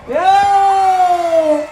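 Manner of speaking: a high, loud cry of delight; triumphant shout. Real, not acted. Host cheering the contestant's century, high loud shout. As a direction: yell out in joy at the hundred.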